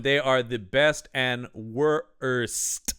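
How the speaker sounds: very slow, "worst" broken into two syllables; syllable-split reading. Retold or imitated. Mock reading the hieroglyphs aloud, slow, "worst" split in two. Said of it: "He breaks that one syllable word into two".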